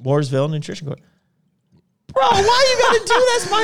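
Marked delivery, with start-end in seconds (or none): 2.1-3.6 high, loud mock outrage; excited outcry. Real, not acted. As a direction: cry out in fake indignation. Mock outrage over mug.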